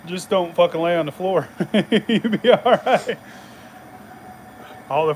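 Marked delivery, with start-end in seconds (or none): 1.6-3.2 chuckling through the words, higher and slow; laughing. Real, not acted. Joking about the carpet, laughing through it. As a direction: say it while giggling.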